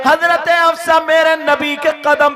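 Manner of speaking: loud, driving sermon at full force; fiery preaching. Acted. Preacher tells the crowd of the Prophet's kindness, fiery full-force preaching. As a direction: preach it out with fervour.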